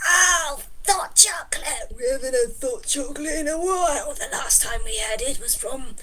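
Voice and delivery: excited little creature gushing about a drink; lightly voiced cheery creature; Eenie character voice. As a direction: chatter eagerly as a cheery critter.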